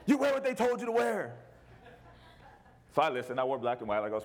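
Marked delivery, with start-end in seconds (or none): 0.0-1.3 wife scolding. Retold or imitated. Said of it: "She jumped all over".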